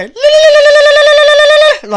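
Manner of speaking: high, loud rapid la-la-la trill; a piercingly high calling trill; ululating call. Shown as a demonstration. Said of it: "like this".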